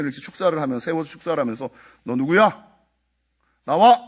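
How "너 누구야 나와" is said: retold exorcist's command, loud and sharp; loud mock exorcism command; commanding shout. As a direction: bark the order at the spirit. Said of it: "이렇게 명한 거예요".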